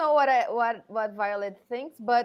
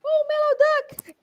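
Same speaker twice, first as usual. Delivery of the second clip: high, loud cry to a pet duck; 6 dB louder than usual. Acted. Player cries out to the duck grabbed with the loot, high outcry.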